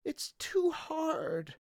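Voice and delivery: very high, slow crying shout; crying wail. Acted. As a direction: wail it out like a boy near tears. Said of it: "said Charlie".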